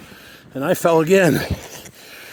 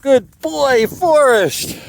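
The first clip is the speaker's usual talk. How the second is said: high praise; 9 semitones higher, much slower than usual. Real, not acted. Owner praising Forrest for making it, high praising voice. Praising animal after the climb.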